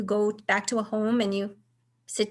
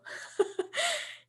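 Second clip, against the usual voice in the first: high breathy laugh; 8 dB quieter than usual. Real, not acted. Laughing after the realization.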